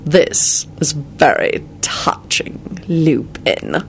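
very slow, breathy sneering villain; icy, whispered sneer; cold sneer. A narrator's reading. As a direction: draw it out with cold mockery. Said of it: "a cold, sneering voice".

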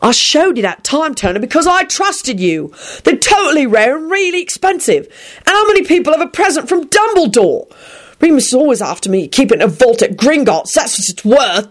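indignant, high-pitched tirade; torrent of invective. A narrator's reading. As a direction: rant on in outraged complaint. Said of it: "this torrent of invective".

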